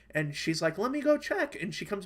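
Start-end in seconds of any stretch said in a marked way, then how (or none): 0.8-1.5 high, chirpy server voice; lightly put-on helpful waitress; mock polite server; imitating the waitress.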